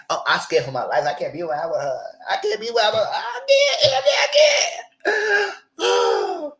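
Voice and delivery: high-pitched hammed-up panicked wail; mock terror. Hammed-up fear, mocking the frightened castmate to Andy. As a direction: shriek it in over-the-top fake fear.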